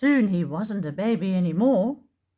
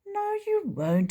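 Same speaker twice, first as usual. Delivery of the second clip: reassuring; 14 semitones higher than usual. Acted. Tiny reassures Tyro.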